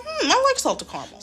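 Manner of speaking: high, quick sing-song hmm; playful. Retold or imitated. Answering the passenger about the truffle, high and playful. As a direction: tease it out, pleased with herself. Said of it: "I'm like".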